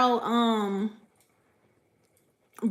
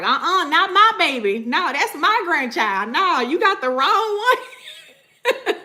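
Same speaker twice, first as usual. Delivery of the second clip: protective granny; 6 semitones higher, 4 dB louder than usual. Pretend. Ancestors defending their grandchild.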